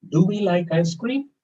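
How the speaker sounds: "Do we like ice cream?" high, rising on "cream"; question intonation. Shown as a demonstration. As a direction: ask it with "cream" lifted high. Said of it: "question and a statement".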